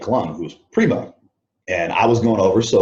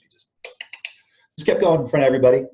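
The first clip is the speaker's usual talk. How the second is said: usual speaking voice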